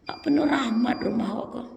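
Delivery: breathy whispered warning; hushed aside to the congregation. Acted. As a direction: drop to a whisper as if telling a secret. Whispered aside after acted scolding.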